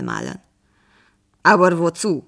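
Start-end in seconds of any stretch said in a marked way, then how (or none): 1.4-2.2 pertanyaan heran yang agak keras; puzzled, surprised question. A narrator's reading. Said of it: "fragte der Maler verwundert".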